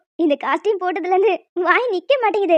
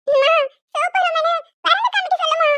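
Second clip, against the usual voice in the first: put-on pleading child; 6 semitones higher than usual. Little girl pleading with her mother. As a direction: coax mum like a small girl.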